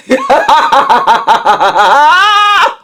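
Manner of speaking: high, bursting laugh at the irony; real laugh. Real, not acted. Laughing at the irony of her stammering past, loud and high. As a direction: let a big laugh break out.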